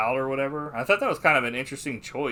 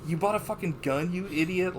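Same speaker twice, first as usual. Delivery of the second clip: retold scolding; 7 semitones higher than usual. Wife scolding husband in retelling.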